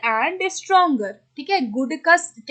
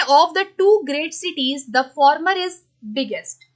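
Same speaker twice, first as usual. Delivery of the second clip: slow dictation; much slower than usual. Shown as a demonstration. Teacher reads the question sentence.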